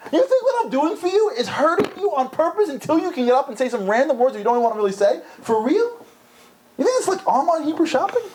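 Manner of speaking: God scolding the bargainers; mock divine exasperation; incredulous God. God rebukes shopping-style prayer.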